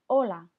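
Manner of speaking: hola said loud and clear, silent h, O-la; teacher models hola; model word for repeating. Shown as a demonstration. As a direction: say hola firmly for the class to repeat.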